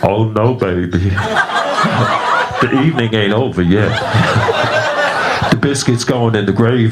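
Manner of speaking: lightly voiced, laughing come-on; seductive LaWanda. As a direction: LaWanda teasing her date, playful. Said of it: "She was like".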